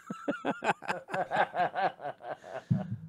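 drawn-out wheezy laugh trailing away; long laugh. Real, not acted. Laughing over hanging up on Tommy, long laugh. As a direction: laugh softly and at length.

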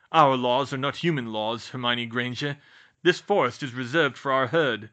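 loud, formal rebuke; stern centaur, hostile. Acted. Bane tells Hermione the herd's laws, sternly. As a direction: lay down the law forcefully.

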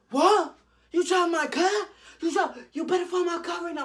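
high, loud, screeching imitation of a mother; hammed-up parental scolding; outraged mom. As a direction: shriek it like a furious mom. Mom told her card is lost.

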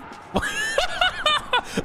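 real laugh shooting way up; high burst of laughter. Real, not acted. Caster laughing at his own English kid impression, high laugh. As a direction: crack up at the bit.

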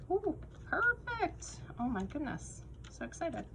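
squealing high with delight; thrilled squeal, pitch jumping up; excited. Real, not acted. Thrilled at finding perfect dots.

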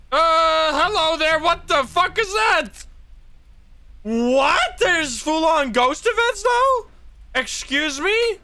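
can't believe what just appeared; shocked squeal. Real, not acted. Shocked by full ghost event.

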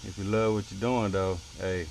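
normal talking voice

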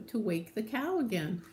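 usual speaking voice

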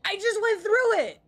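high-pitched outraged protest; exasperated cry. Real, not acted. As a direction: cry out in disbelief at the game. Exasperated cry at game glitch.

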